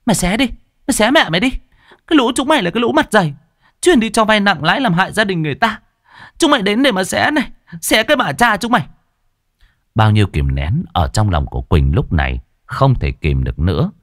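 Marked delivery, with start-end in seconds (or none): 0.1-8.9 high, fast, furious comeback; defiant retort. A narrator's reading. Girl talking back to the loan sharks, defiant retort. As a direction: hurl the threat right back, high and fast. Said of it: "Quỳnh cũng không vừa cự lại".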